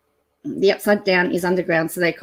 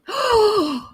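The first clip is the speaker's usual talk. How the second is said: high, sharp gasp of delight; excited gasp; 12 semitones higher than usual. Real, not acted. Spotting a new burger on the menu, high excited gasp. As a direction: gasp at the find. Finds the double Aussie.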